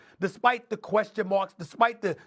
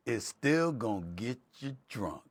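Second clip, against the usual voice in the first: low, soft, drawn-out delivery; slowed down; 8 semitones lower, 7 dB quieter than usual. Shown as a demonstration. Demonstrating to Big Perk how to slow the line down, low and slow. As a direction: drop low and quiet, stretch it out slowly. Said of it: "you need to talk slow and slow it down".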